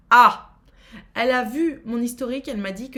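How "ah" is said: surprised exclamation. Real, not acted. Shocked by the escort site.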